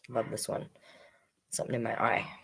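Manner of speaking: ordinary delivery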